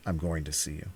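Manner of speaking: the rival answers the brother, low and even; low stranger's voice. Acted. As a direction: drop into the cool stranger. Stranger's reply in read story.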